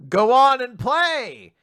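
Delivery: loud, slow, drawn-out shout; shouted taunt. Retold or imitated. Recapper shouting a villain's taunt at heroes. Reading Andy's shouted line.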